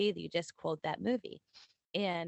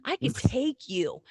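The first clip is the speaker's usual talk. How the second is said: higher, louder hammed-up trash talk; mock tough-guy challenge; 8 semitones higher, 6 dB louder than usual. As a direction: square up to a three-year-old for laughs. Mock quarrel with toddler.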